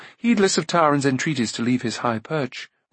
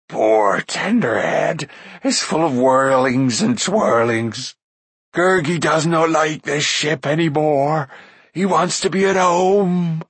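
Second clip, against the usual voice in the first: slow, odd creature voice, louder and whining; pitiful wailing Gurgi; 4 semitones higher, 4 dB louder, slower than usual. A narrator's reading. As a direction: moan like a seasick little beast. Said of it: "Gurgi wailed and howled pitifully".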